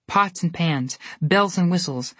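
ordinary delivery, nothing marked

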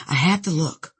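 ordinary delivery, nothing marked

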